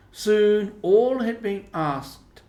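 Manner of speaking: slow, loud, drawn-out stress; emphatic narration. A narrator's reading. Narrator stressing that nobody else was left out, slow and loud. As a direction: lean on every word to stress it.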